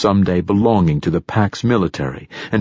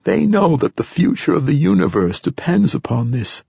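put-on higher-pitched old man's voice; old man character, pitched up; old satyr poet; 8 semitones higher than usual. A narrator's reading. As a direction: voice an ancient poet foretelling events. Old poet continues speaking.

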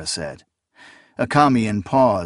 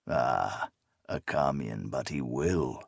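put-on cold old sorcerer; 8 semitones lower, 9 dB quieter than usual. A narrator's reading. Old sorcerer's cold amused reply.

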